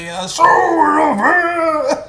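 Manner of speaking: high, loud mock police yell; slow, over-the-top copy of her order; imitating shout. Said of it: "That's how she said".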